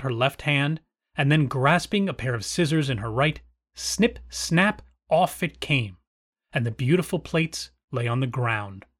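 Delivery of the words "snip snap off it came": ordinary delivery, nothing marked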